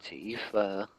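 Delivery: hushed teasing. Pretend. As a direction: gently tease someone who dozed off. Calling softly to someone maybe asleep.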